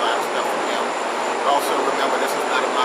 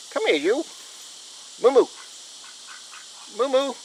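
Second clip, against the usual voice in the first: high coaxing pet call, much higher; 10 semitones higher, 7 dB quieter than usual. Real, not acted. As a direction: sing the name out high to lure her over. Calling the cow-like pet over.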